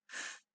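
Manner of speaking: F as pure air, no voice; unvoiced f sound. Shown as a demonstration. Teacher shows students the changing sounds, unvoiced F. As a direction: blow a short F without the voice box. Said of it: "The f sound is unvoiced".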